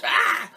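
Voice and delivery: high squawk; storybook bird squawk; parrot screech. A narrator's reading. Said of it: "The parrot screeched".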